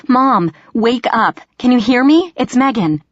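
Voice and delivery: urgent, high-pitched calls; frantic pleading. A narrator's reading. Daughter shaking her unconscious mother, frantically pleading.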